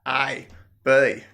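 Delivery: A with the vowel drawn toward "eye"; broad Aussie A. Shown as a demonstration. Tour guide showing how Australians sound their letters, broad Aussie vowels. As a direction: say A flattened the Ocker way. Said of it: "often comes out as A".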